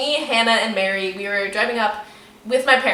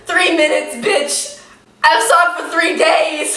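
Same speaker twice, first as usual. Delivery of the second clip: loud, higher mocking retort, last line slow; sarcastic scoff; 6 semitones higher, 6 dB louder, slower than usual. Real, not acted. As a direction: drag him, slow and scornful on three days. Mocking his three-minute sob claim.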